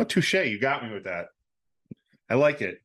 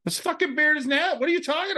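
indignant; 13 semitones higher than usual. Real, not acted. Indignant outburst, much higher.

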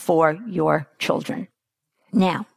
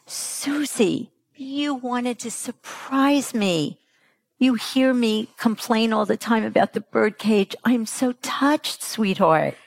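tender mother; 3 semitones higher than usual. Retold or imitated. Mother gently thanks her daughter for the surprise, tender.

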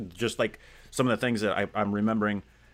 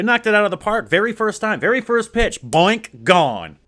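excited praise; 11 semitones higher, 10 dB louder than usual. Real, not acted. Praising host's first story.

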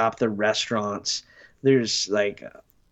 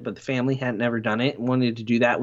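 usual speaking voice

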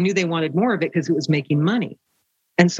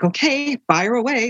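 high, lilting imitation of a British accent; lightly mimicked British voice, pitched up; 7 semitones higher than usual. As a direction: put on a little British accent. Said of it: "with that little British accent".

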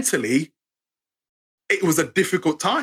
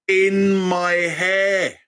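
drawn out; much slower than usual. Retold or imitated. Man telling of a hand touching him, drawn out. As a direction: stretch every word out slowly. Drawn-out punchline of his story.

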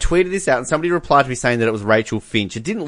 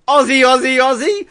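high mock cheerleading chant; Rachel Finch; 12 semitones higher than usual. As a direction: imitate a reporter prompting a crowd chant, pitched up. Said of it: "Rachel Finch or whatever goes".

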